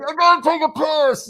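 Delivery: high, loud, rushed mock announcement; imitated overheard voice. Co-host retells what he heard someone say, in a high mock voice.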